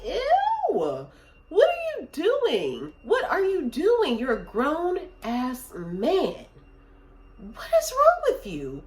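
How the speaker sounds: shrill disbelief at a grown man sulking; disgusted outrage. Retold or imitated. Retelling scolding the sulking guest in bed, high disgusted outrage. Retold quarrel with entitled guest.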